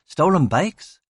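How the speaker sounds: usual speaking voice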